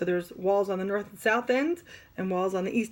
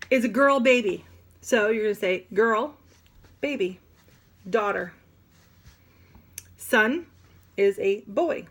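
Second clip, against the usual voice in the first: usual speaking voice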